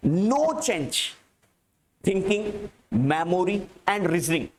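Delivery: word groups parted by pauses; halting, measured classroom dictation; slow dictation. Real, not acted. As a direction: dictate in small chunks, leaving time to write. Repeats dictated sentence for writing.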